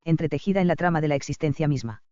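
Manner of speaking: ordinary delivery, nothing marked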